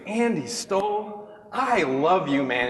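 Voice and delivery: slow, drawn-out name then a warm declaration; a hint of a gushing cheerleader; lightly voiced cheerleader. Tiffany hugging Andy, lightly voiced slow gushing declaration. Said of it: "she goes".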